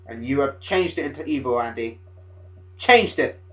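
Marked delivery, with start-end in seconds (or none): 2.8-3.4 high, loud accusing yell; outraged shout. Acted. Fan scolding Andy for changing the method, outraged shout. Mock rant addressed to Andy.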